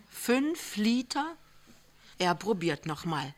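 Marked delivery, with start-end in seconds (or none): 0.1-1.4 slow, much higher disbelieving echo; incredulous. A narrator's reading. Lämmchen echoing the amount in slow disbelief. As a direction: echo it, stunned.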